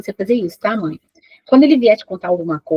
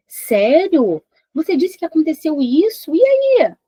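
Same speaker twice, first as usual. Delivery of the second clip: eager, rising higher to a bright high E aí; interested tone; 7 semitones higher, 4 dB louder, slower than usual. Shown as a demonstration. Mãe mostrando interesse ao filho que conta algo, tom animado. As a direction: show you really want to hear more. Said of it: "a última frase que ele disse em tom de interesse".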